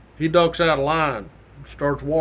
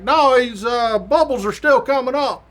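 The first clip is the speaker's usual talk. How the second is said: high-pitched imitation of a called answer; retold shout back, pitched up; yelling back; 7 semitones higher, 4 dB louder than usual. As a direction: holler the reply across a pool. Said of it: "Trooper yells back".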